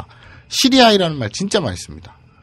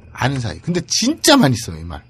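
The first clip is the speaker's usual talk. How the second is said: ordinary talk, nothing marked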